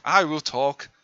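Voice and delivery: ordinary talk, nothing marked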